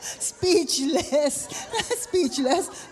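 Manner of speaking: laughing through the word; teasing, amused delivery. Real, not acted. Laughing at speechless volunteer.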